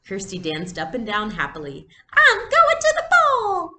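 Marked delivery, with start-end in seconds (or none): 2.1-3.7 very high, slow, loud little-girl pretend voice; giddy high make-believe voice. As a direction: squeal it up high like a child playing dress-up. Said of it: "she announced in a high pretend voice".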